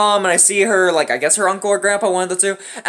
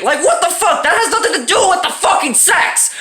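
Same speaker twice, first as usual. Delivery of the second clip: high, incredulous outburst; exasperated rant; 9 semitones higher than usual. Real, not acted. Son ranting about his smashed console, exasperated. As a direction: vent in indignant disbelief, pitched up.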